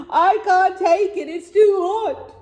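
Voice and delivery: high, slow, whiny moan; moaning camel. Acted. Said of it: "she would moan".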